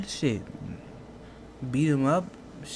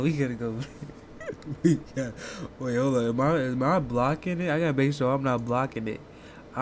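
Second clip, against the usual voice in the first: usual speaking voice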